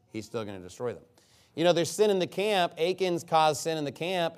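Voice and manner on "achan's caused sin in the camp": ordinary talk, nothing marked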